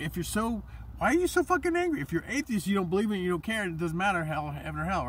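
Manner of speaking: high, loud mock outburst easing off; imitated exasperated rant, starting very high; exasperated challenge. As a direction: fire it back in exaggerated disbelief. Acts out challenging the angry atheist.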